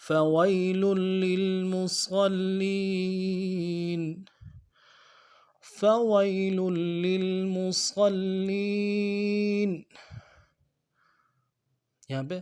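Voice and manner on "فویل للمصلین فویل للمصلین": slow melodic tajweed chant; Quran recitation. Shown as a demonstration. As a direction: recite the verse with care.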